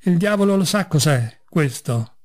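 ordinary delivery

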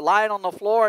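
ordinary talk

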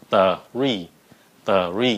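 THREE broken into TUH-REE; split, deliberate. Shown as a demonstration. Teacher building up thr for learners, split THREE. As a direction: pull THREE apart into two sounds, th then ree. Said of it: "Nhanh lên".

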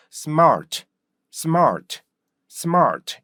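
smart as one falling beat with springy stress; joined English rhythm. Shown as a demonstration. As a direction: release smart in one punched syllable. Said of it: "이렇게 발음하는데". (탄력 있게 한 박자로 smart)

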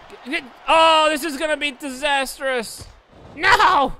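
high, loud panicked cry of no; yelling in real alarm as the match goes wrong; panicked shout. Real, not acted. Gamer shouting in panic at the screen.

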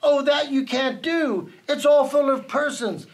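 high, urgent alarm; gasped protest. A narrator's reading. Elephant defends the tiny persons, alarmed. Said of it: "gasped Horton".

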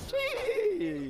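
squeaky high seahorse voice; a strange high seahorse squeak. Real, not acted. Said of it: "I sound like a seahorse".

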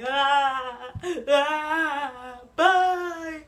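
high, loud, stretched goodbye cry; loud farewell call. Retold or imitated. From the car to family on balconies, loud sobbing goodbye. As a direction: call out bye across the street, half sobbing. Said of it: "waving like".

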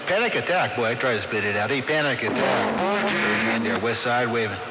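usual speaking voice

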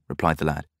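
usual speaking voice

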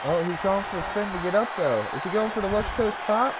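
rising, eager play-by-play; wrestling commentator. Acted. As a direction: build the hype as the move sets up. Lively match play-by-play.

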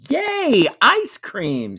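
put-on very high, loud kid's cheer; loud squeal of delight, starting high; screeching cheer. A narrator's reading. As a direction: screech it with excitement. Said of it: "screeched Ethan".

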